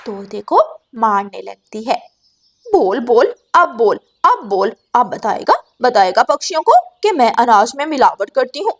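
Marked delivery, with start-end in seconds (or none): 2.7-8.7 louder, furious taunting; angry beating. Acted. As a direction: demand an answer with each blow. Gauri beats the parrot.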